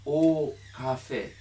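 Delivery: slow, loud おカフェ with a soft drawn-out お in front; soft added o. Shown as a demonstration. Teacher showing a student the soft お in おカフェ. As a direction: lean into the extra お in おカフェ, slowly. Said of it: "おか-、でも柔らかい".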